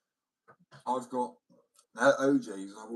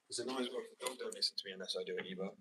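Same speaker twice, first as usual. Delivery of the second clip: ordinary talk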